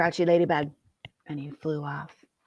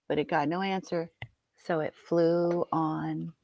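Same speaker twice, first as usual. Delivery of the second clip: ordinary delivery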